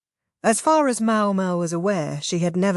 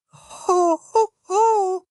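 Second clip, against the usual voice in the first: high, hammed-up lady's laugh; mock laugh; 13 semitones higher, much slower than usual. Sister laughing off her brother's protest, high mock laugh. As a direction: ho ho ho, theatrical and high.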